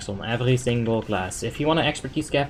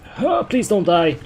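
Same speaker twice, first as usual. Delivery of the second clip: nervous plea; 9 semitones higher, 7 dB louder, slower than usual. Real, not acted. Nervous plea during boss pull.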